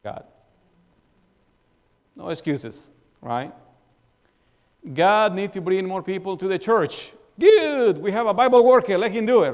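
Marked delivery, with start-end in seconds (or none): 4.9-7.1 raised, louder voicing of the easy excuse; mock churchgoer being complacent. Quoting a complacent churchgoer's thought. 7.4-9.5 much higher and louder, brushing off the job; hammed-up churchgoer happy to let the Bible worker do it; mock smug excuse.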